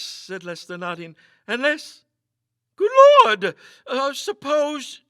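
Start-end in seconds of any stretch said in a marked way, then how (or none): 1.5-5.0 high, loud, frightened outburst trailing off slowly; trembling fear. A narrator's reading. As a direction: let the dread break through, then falter.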